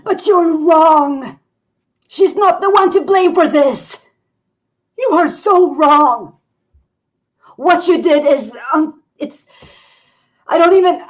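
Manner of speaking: outraged shout. Acted. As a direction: rage until words fail. Accusing the villagers.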